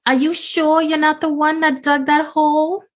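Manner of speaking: high, sing-song flirty southern lady; flirty Belinda. Acted. Belinda sweet-talking the farmer, high and coy. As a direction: coo his name up high like a flirt.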